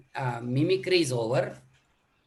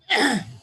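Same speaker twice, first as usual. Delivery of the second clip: sharp throat cough; demo cough; 7 semitones higher, 4 dB louder than usual. Real, not acted. A participant asked to cough, short throat cough. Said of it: "you are bringing it much more deeper".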